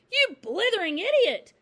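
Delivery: high, full-force insult; furious shout. A narrator's reading. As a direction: bellow it at the boy in a fury. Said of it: "shouted the Trunchbull".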